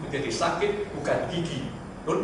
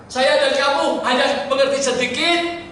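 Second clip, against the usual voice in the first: ordinary talk, nothing marked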